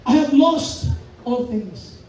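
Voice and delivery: very slow, each word weighed; slow solemn reading. Shown as a demonstration. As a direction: let every word of "lost" land slowly. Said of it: "tingnan niyo". (mabagal at mabigat na pagbasa ng talata)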